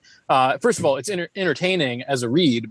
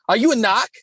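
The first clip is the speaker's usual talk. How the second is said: put-on Boston accent; 8 semitones higher than usual. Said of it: "thick Boston accents".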